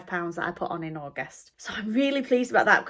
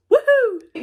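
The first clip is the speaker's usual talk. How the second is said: loud, much higher celebratory whoop; excited whoop; 10 dB louder than usual. Real, not acted. Cheering her weight loss to viewers, loud happy whoop.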